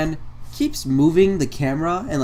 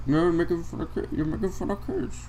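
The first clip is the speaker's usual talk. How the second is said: Jake Paul; 3 semitones higher, 7 dB quieter than usual. Retold or imitated. Mocking Jake Paul insisting on his complaint, soft whiny imitation. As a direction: mimic a feeble, repetitive accuser. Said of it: "Jake Paul is just like".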